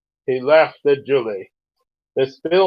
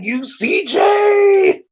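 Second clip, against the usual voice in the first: playful shout; much slower than usual. Real, not acted. Playfully yelling at her friend for the joke.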